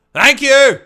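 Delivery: high, loud cheery shout; loud high boozy thanks; homeless fella thanking. Retold or imitated. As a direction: shout thanks like a happy drunk. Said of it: "And I go".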